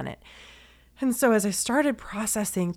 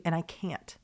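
getting emotional; 7 dB quieter than usual. Real, not acted. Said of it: "I'm gonna get emotional".

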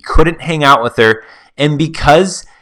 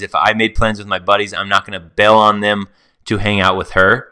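ordinary talk

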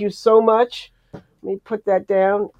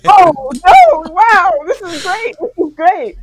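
high, loud fan gushing; starstruck excitement; 7 semitones higher, 6 dB louder than usual. Retold or imitated. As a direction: gush like meeting your idol. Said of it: "I was like".

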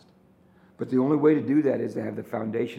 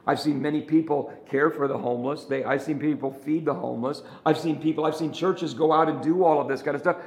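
ordinary talk

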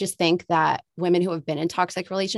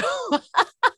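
high, breathy laugh through tears; emotional laugh. Real, not acted. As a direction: laugh it off while moved.

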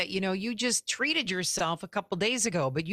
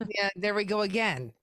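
normal talking voice

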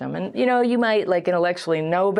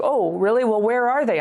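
higher-pitched mock-wondering comeback; sceptical retort; 5 semitones higher than usual. Answering advice to find a good therapist, sceptical retort. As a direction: challenge it with pointed disbelief.

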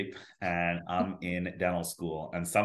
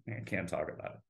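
same words again, much softer, trailing off; mock-haunted fade; 3 semitones lower, 9 dB quieter, faster than usual. Refusing to talk about dental school trauma, fading to a hush. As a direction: let it die away as if haunted.

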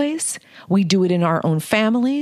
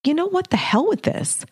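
retelling the moment she'd had enough; fed-up outburst; 10 semitones higher than usual. Retold or imitated. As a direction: pitch jumps way up, exasperated. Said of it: "I'm like".

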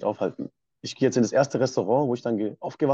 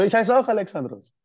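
higher and louder, amazed at the shared name; surprised delight; 9 semitones higher, 5 dB louder than usual. Retold or imitated. Restaurant owner amazed they share a name, surprised delight. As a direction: exclaim it like a happy coincidence. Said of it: "Der so".

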